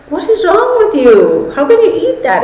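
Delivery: imitating a grossed-out friend; lightly voiced disgusted friend. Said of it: "And she goes".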